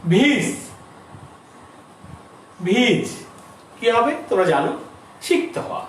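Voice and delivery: ordinary talk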